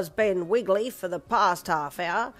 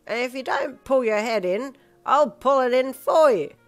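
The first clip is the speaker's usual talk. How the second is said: loud, slow, slurred telling-off; blunt drunk scolding; 6 semitones higher, 9 dB louder than usual. Acted. Drunk Pa tells his date off, loud and slurred. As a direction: scold her bluntly, mouth barely forming words. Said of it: "Pa said bluntly".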